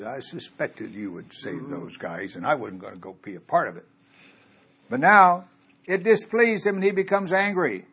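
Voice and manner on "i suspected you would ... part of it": grumbling Jonah. Retold or imitated. Preacher voices a grumbling Jonah.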